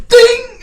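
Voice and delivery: very high, loud bat-hit ding; cartoon ding. Acting out the bat strike, very high loud ding. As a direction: squeal the impact sound.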